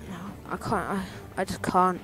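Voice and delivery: usual speaking voice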